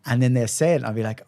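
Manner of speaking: usual speaking voice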